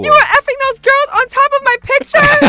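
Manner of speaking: high, loud shocked accusation; outraged accusation. Retold or imitated. Confronting the cheating boyfriend, she yells high and loud. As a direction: accuse him in disbelief, loud. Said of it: "So I was like".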